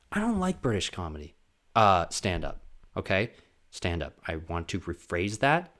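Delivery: ordinary delivery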